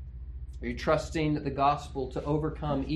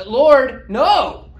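much higher, loud outburst with a laugh; instinctive refusal; 10 semitones higher, 13 dB louder than usual. Retold or imitated. Peter blurting a refusal at his Lord, loud and high. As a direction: blurt it out like a startled man refusing.

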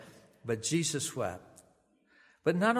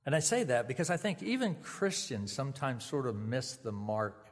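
ordinary talk

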